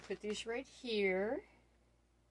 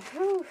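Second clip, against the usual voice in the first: whew; 12 semitones higher, 9 dB louder than usual. Real, not acted. Overwhelmed while unboxing gifts.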